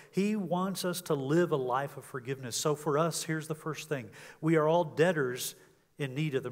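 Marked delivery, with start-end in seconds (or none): none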